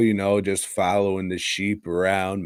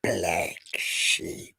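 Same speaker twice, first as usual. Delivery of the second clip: whispered title; 3 semitones lower, 6 dB quieter than usual. Pretend. Dramatic title drop of movie.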